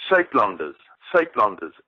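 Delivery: "Saatlanders" said the Afrikaans way, twice, falling at the end; careful demo of "Saatlanders" in its native sound; Afrikaans pronunciation. Shown as a demonstration. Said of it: "what is the exact pronunciation of that again?".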